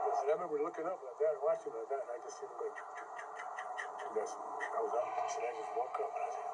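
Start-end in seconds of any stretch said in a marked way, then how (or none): 2.8-4.1 whispered choo sounds. Retold or imitated. Imitates medical machine sound.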